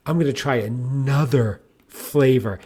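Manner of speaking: slow build-up on "another flavor"; spaced-out, suspenseful "another flavor"; dramatic reveal. Retold or imitated. Building up his text message.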